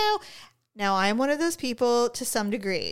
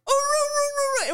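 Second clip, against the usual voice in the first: imitated voiceless dog bark. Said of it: "It was like this weird".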